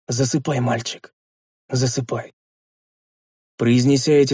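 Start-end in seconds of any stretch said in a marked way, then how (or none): 0.1-2.3 soothing lullaby voice. A narrator's reading. Lulling dying boy to sleep.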